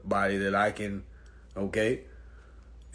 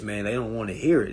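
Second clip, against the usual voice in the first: usual speaking voice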